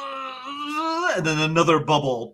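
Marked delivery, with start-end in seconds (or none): 0.1-1.1 strained grunt. Acted. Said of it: "And he goes".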